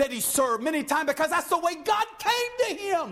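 high, impassioned pulpit delivery; fervent preaching. Real, not acted. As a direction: preach it with rising fervor. Preaching rises in force.

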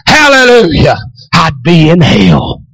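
high, loud, rhythmic revival preaching; fervent tent-meeting preacher crying out; preaching at full force. Acted. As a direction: preach it out to the congregation, near crying.

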